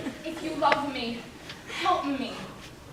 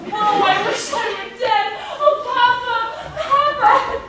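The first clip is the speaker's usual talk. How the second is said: higher, louder, breaking plea; desperate cry; 7 semitones higher, 12 dB louder, slower than usual. Acted. Bride pleading with her father before the wedding, a desperate cry. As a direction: cry out to your father in panic.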